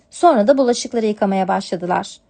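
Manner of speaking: usual speaking voice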